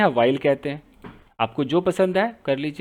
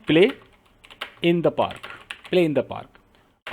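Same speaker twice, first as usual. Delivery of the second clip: slow dictation; 3 semitones lower, 5 dB quieter, much slower than usual. Shown as a demonstration. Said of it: "teacher models the phrase slowly".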